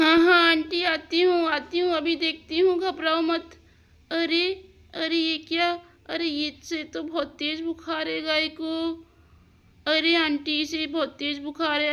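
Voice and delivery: urgent helper. Acted. Radhika hurrying to aunt's sick cow, high and urgent.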